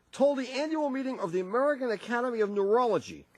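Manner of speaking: usual speaking voice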